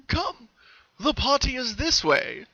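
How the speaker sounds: high, slow, coaxing; beckoning colt. Acted. The colt leading them into the forest, coaxing. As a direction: lure them gently.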